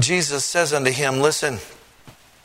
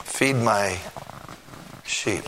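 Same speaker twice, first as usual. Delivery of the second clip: gentle; 4 semitones lower, 5 dB quieter, slower than usual. Acted. Jesus answers softly.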